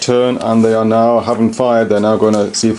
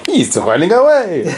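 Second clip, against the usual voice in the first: mock alarm; 8 semitones higher than usual.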